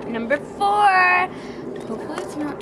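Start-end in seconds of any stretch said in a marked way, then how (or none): 0.1-1.3 excited. Real, not acted. Picking her next can.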